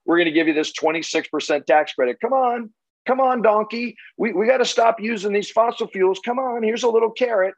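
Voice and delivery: high, wheedling come-on voice; coaxing the donkey. Retold or imitated. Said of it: "That's the solar investment tax credit".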